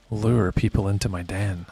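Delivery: low, mock-seductive purr; sexy voice. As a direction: lure them in with a put-on sexy voice. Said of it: "I put my sexy voice on".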